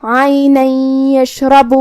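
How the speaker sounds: عینا merged into یشرب with a nasal hum; idgham with ghunna. Shown as a demonstration. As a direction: run عینا into یشرب, nun absorbed with ghunna. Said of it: "تو ہم یہ حکم کو ایسے ادا کریں گے". (عینا یشرب میں ادغام بغنہ)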